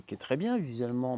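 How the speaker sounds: ordinary delivery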